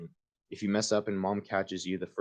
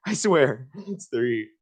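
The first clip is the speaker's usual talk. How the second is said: exasperated; 6 semitones higher, 6 dB louder, much slower than usual. Real, not acted. Still exasperated at game.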